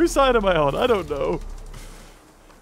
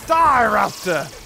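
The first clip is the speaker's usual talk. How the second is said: battle cry; 6 semitones higher, much slower than usual. Real, not acted. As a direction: yell it while attacking. Attacking raptor in battle.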